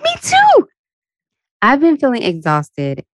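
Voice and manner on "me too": squeaky voice pitched far up; mock high squeak. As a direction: chirp it back in a silly high voice.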